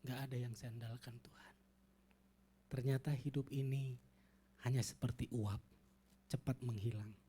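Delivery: hushed, low, unvoiced tearful plea; wife whispering a tearful prayer to God; whispered tearful prayer. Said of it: "Dia nangis".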